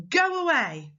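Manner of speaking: high, bossy order falling at the end; bossy command. Shown as a demonstration. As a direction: order someone off. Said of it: "the way I changed my voice for those".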